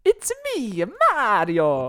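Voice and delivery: high, hammed-up Italian accent; exaggerated mock-Italian catchphrase voice; Mario. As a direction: do a cartoonish Italian game-hero voice, starting high. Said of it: "thank God she doesn't sound like that".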